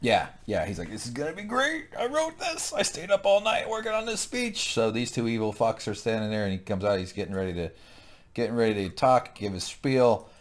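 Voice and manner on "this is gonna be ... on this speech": high, excited put-on voice; giddy mock villain. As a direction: gush like a proud schoolboy. Mock villain excited about speech.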